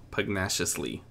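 ordinary delivery, nothing marked

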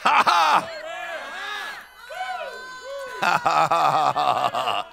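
punched-out preacher's laughter; exultant laugh. Real, not acted. Laughing in celebration.

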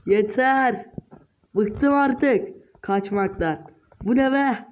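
ordinary talk

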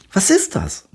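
put-on high, puzzled little girl voice; wondering piglet. A narrator's reading. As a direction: ask it like a curious piglet. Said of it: "wundert Pepper sich".

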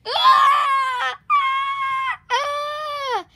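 very high, loud drawn-out "ah" screams; long acted attack yells; battle scream. High-pitched fighting scream. Gorilla's yell as fight starts.

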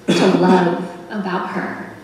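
ordinary talk, nothing marked

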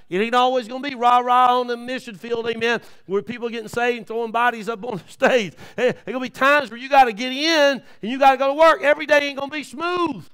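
loud, high fired-up preaching; preaching at full force. Acted.

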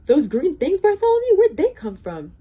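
very high, loud, startled outcry; alarmed. A narrator's reading. Trumpeter spots the oobleck, loudly alarmed. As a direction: blurt it out in high-pitched panic.

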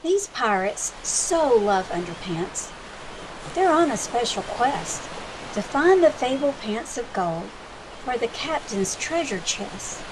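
lively storybook read-aloud with big swings; reading to children. Acted. Librarian reading a pirate picture book to children, lively storytime voice. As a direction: read it like storytime for little kids.

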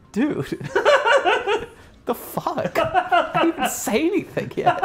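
high pitched laughing protest. Real, not acted. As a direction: laugh through the words. Laughing at friend's remark.

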